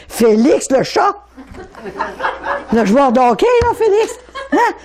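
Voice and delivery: voix qui monte en riant au prénom Félix; rire amusé. Real, not acted. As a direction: rire du chat et du joueur de hockey. (rire léger et aigu)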